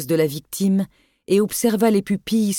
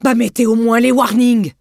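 exasperated shout; 5 semitones higher, 7 dB louder than usual. Acted. Doctor snaps back in storm.

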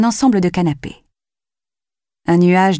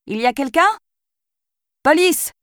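calling out; 11 semitones higher than usual. Acted. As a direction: call out high, unsure who's there. Calling into a dark house. (appel fort dans la maison sombre)

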